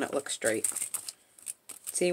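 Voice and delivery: usual speaking voice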